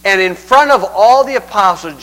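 ordinary talk, nothing marked

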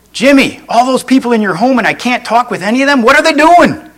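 high, rushed exasperation; caller freaking out. Acted. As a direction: climb in pitch to a frantic question. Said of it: "the guy's freaking out".

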